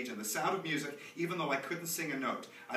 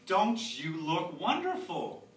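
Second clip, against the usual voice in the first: gushing; 4 semitones higher, 7 dB louder, much slower than usual. Acted. Parent gushing to child.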